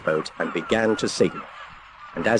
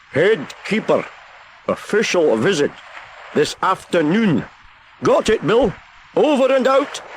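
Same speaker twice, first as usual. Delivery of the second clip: excited keeper; 11 semitones higher than usual. A narrator's reading. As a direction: proudly sign off the message. Keeper confirms the message wrongly.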